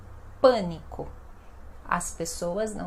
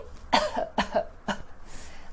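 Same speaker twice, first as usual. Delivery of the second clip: breathy mock cough; demo cough. Shown as a demonstration.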